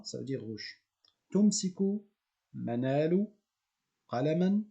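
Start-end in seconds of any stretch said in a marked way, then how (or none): 1.3-4.6 each word set apart, clearly articulated; model reading. Shown as a demonstration. Teacher models the sentence again for the class, clear. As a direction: present the full sentence to repeat. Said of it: "Nous allons décomposer cette phrase".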